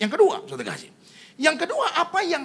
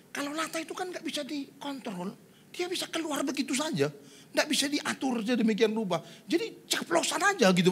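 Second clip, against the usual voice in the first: high, meek protesting voice; imitated friend pleading helplessness; apologetic friend; 9 semitones higher than usual. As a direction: make excuses in a raised, lighter pitch. Friend excuses relative to pastor.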